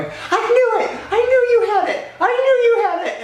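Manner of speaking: very high, loud squealed scream; screaming with excitement. Retold or imitated. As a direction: imitate a friend shrieking in triumph. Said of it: "screaming and crying while A D is going".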